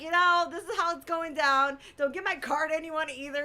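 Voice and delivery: high, insistent instructions; emphatic high-pitched orders; bossy orders. Retold or imitated. Acted orders about belongings.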